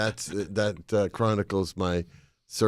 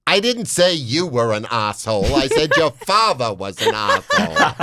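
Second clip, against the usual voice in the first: raised, louder mock argument, laughing through the end; retold quarrel; 6 semitones higher, 6 dB louder than usual. Retelling a stranger's airport fight, mock angry and laughing. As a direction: play the fighting stranger, cracking up. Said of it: "One said".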